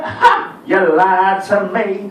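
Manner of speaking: slow, drawn-out taunt; childish mocking retort. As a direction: throw her words back like a kid.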